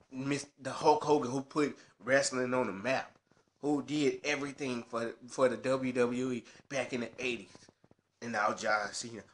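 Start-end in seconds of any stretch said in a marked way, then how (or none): none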